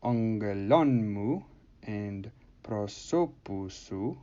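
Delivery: "ongelonmu" and "prosopusu" slowly, each run together as one word; "ongelonmu" stressed on lon, "prosopusu" drawn out, endings leaning on the word before; enclitic pronunciation demo. Shown as a demonstration. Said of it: "so you would pronounce this".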